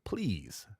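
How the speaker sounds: ordinary talk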